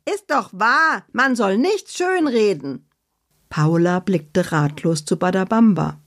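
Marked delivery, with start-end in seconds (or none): 0.1-2.9 high, cheeky defiant retort; Annabella defiant. Acted. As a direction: shrug off the rebuke in a high voice. Annabella's character voice.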